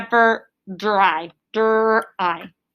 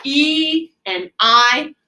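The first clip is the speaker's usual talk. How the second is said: each vowel said slowly and stretched out; teacher stressing the vowels for the class; slow, stressed; much slower than usual. Shown as a demonstration. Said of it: "long vowel sound".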